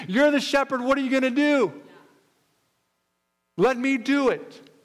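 high, insistent plea; impassioned urging. Acted. David pleads with King Saul to fight, impassioned.

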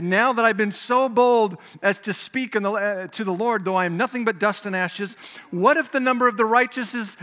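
loud, high, pushing harder; bold pleading. Acted. Abraham daring to speak to the Lord again, loud bold pleading.

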